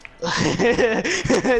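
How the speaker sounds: laugh. Real, not acted. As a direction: break into a quick laugh. Amused in game.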